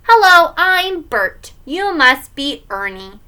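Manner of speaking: put-on slow, high, loud kid's puppet voice; little boy's drawn-out character greeting; Bert. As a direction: introduce yourself like a TV puppet. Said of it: "his best Sesame Street voice".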